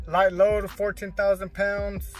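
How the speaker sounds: usual speaking voice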